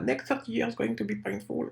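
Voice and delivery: high-pitched attempt at a Chinese accent; put-on Chinese accent, pitched well up; mock Chinese accent. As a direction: have a go at imitating a Chinese-accented English quote. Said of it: "vous l'imaginez avec son accent, euh, chinois".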